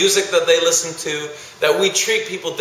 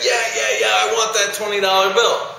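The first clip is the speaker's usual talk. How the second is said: mock eager students; 6 semitones higher than usual. Students still wanting the bill.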